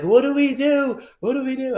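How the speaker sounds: panicked customer. Retold or imitated. Customer panicking over his burning show car, high mock panic. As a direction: squeak it out like a man watching his car burn. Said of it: "This dude is panicking".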